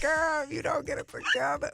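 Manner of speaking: high-pitched playful telling-off; mock scolding. Host imitating her guest's look at her, mock scolding. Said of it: "she looked at me like".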